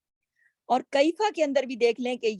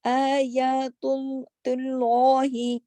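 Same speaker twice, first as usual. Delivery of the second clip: آیات اللہ slowly, long vowels held; slow Qur'anic recitation; much slower than usual. Shown as a demonstration. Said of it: "لام موٹا پڑھا جائے گا".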